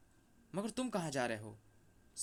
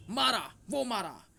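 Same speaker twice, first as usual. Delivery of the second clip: loud, high, drawn-out shouts; excited cheering; 6 semitones higher, 6 dB louder, slower than usual. Acted. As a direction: whoop it out like a roaring spectator. Crowd cheering at the game. (जोश में चिल्लाते हुए)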